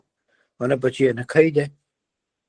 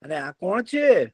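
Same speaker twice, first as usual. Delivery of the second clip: high, slow crow voice; curious crow; 8 semitones higher, slower than usual. Acted. Crow asks the deer who the stranger is, curious. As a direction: call out like a nosy crow.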